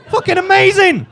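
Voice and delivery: high, loud exclamation; amazed delight. Real, not acted. Comic to audience about the rat, thrilled shout. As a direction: burst out loudly in sheer wonder. Comic marvels at his own story.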